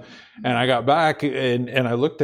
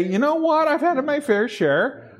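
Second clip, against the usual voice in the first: higher, fed-up mock voice of a weary believer; mock fed-up quote; 10 semitones higher than usual. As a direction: play the worn-out churchgoer throwing up their hands. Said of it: "would just like to ... say".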